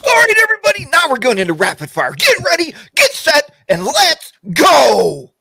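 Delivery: loud, high-pitched hype announcer; over-the-top excited yell. Acted. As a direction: yell it like a race start, stretching the last word. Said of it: "watching you yell at me twice".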